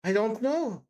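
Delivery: mildly mournful reply; understated, regretful answer. A narrator's reading. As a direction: say it with a touch of sorrow. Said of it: "Sonia articulated mournfully".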